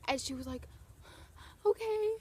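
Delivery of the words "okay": very high-pitched starstruck answer; imitated overwhelmed fan. Crying fan is offered a selfie, high imitated reply. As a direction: squeak it out, imitating a stunned fan.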